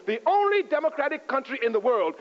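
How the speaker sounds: "only" lifted and pressed, the rest flowing; stress on only. Shown as a demonstration. Driving home Haiti's unique freedom, stressed "only". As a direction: underline "only" with your voice. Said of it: "underline the word only".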